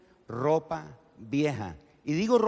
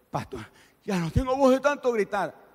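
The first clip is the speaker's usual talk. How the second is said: mock hoarse complaint; 8 semitones higher than usual.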